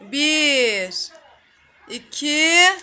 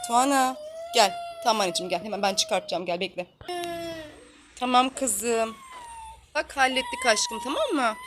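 much softer, gentle hush to calm her; hushed soothing; 3 semitones lower, 9 dB quieter than usual. Real, not acted. Mother hushing her crying daughter while fixing her hair, soft and soothing. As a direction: murmur softly so she stops crying.

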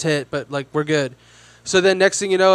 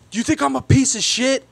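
irate challenge; 6 semitones higher than usual. Retold or imitated. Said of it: "So he was like".

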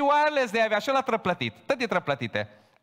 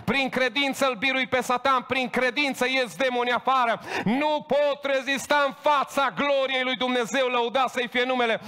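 fiery declaration of faith; 8 semitones higher, 3 dB louder than usual. Pretend. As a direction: proclaim victory over Satan at full force, climbing higher. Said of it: "și spun". (proclamație aprinsă, sus și tare, tot mai intensă)

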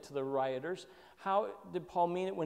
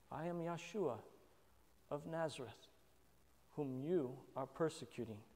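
softer, unhurried, with pauses between phrases; humble and soft; 8 dB quieter than usual. Retold or imitated. Preacher voicing Jesus's humble reply to Paul, soft and paused. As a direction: lay each phrase down gently. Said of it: "His humanity is really emphasized".

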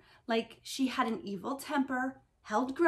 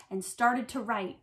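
usual speaking voice

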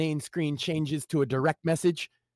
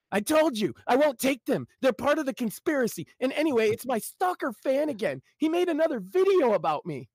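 high, whining paranoid man; whiny Jimmy; 9 semitones higher than usual. Acted. Jimmy whining back to his brother, high and whiny. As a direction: whine it up high like a paranoid loser.

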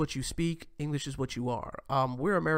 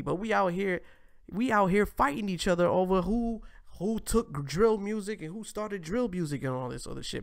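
usual speaking voice

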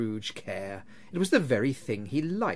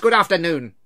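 loud, raised interruption; impatient dismissal; 5 semitones higher, 10 dB louder than usual. A narrator's reading. Scrooge interrupting his nephew's appeal, loud impatience. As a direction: talk over him, loud and final.

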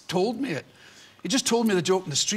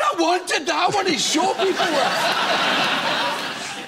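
mock indignant whine; 8 semitones higher, 3 dB louder, much slower than usual. Retold complaint to Pam.

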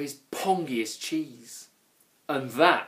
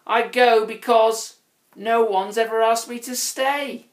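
high, plaintive troll lament; sad little troll; 9 semitones higher, 6 dB louder than usual. A narrator's reading. Grouch was never asked to stay, high and plaintive. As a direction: pour out the hurt, high and loud.

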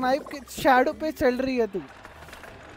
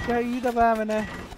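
usual speaking voice

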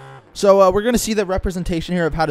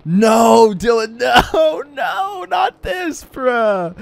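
laughing; 11 semitones higher, 3 dB louder, much slower than usual. Real, not acted. Stuck after wreck, laughing.

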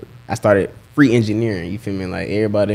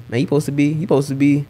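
higher-pitched imitation of people pressing you; raised-pitch, nagging questions; mock pestering; 6 semitones higher than usual. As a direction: mimic insistent people checking up, voice lifted. Acting out people pressing him.

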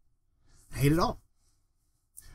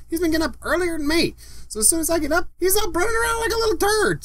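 very high, squeaky exasperated complaint; comic exasperation; 10 semitones higher than usual. Real, not acted. Parent complaining about early-rising son, high exasperation. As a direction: push it up high, climbing to a squeal.

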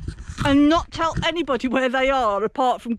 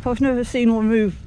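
normal talking voice

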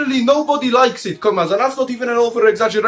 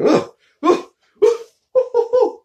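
rising groans of disgust, climbing much higher; 13 semitones higher than usual. Acted. Narrator groaning at Erdogan's face, rising disgusted groans.

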